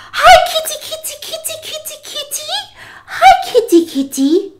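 extremely high sing-song kitty call; cat-calling voice. Retold or imitated. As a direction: imitate someone cooing to cats in falsetto. Imitates woman calling her cats.

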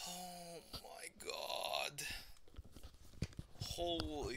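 slow, breathless whisper; slow, hushed disbelief. Real, not acted. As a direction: whisper it in defeat. Muttering in disbelief.

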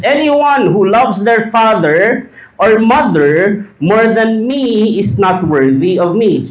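slow, loud, deliberate reading of the verse; solemn scripture reading. Shown as a demonstration. Preacher reading Jesus' words to the congregation, slow and loud. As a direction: read each word out slowly to the congregation. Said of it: "Matthew ten thirty-seven reads".

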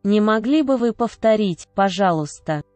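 usual speaking voice

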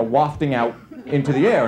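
ordinary talk

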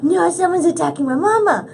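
high-pitched gasp of cat panic; mock freak-out. Cat seeing its owner attacked, hammed-up freak-out. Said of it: "he freaks out, he's like".

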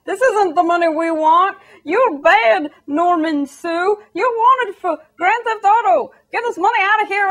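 high, loud mock panic from scandalized politicians; hammed-up outrage, pushing the cash away; mock panicked shouting. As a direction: yell it like the money is on fire. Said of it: "are all like".

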